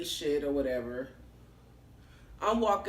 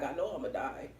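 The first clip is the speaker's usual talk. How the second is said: doomed murmur; 5 semitones lower, 8 dB quieter than usual. Retold or imitated. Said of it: "I was like".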